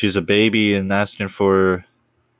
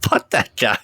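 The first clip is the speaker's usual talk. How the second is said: usual speaking voice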